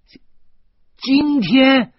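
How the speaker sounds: very high, loud yelp; stunned. A narrator's reading. Husband learning they leave today, stunned yelp. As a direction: catch on the syllable, then shriek it. Shocked at tonight's departure.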